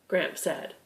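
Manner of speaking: usual speaking voice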